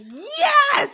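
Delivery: high, loud thrilled shout; excited yes. Real, not acted. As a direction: cheer like your favourite just walked in. Thrilled at seeing Wes.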